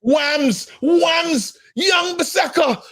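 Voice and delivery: high, stretched shouts of a player's name; excited cheering. Real, not acted. Fan hailing Wan-Bissaka's play, excited shouting.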